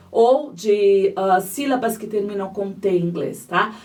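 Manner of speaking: normal talking voice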